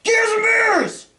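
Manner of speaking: high, slow shouted toast; shouting. Real, not acted. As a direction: belt it out like a toast.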